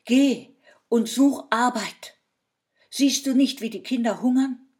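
loud, high angry reproach; scolding wife. Acted. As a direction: scold him hard for his foolishness. Wife scolds her husband.

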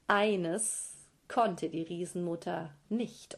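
ordinary delivery, nothing marked

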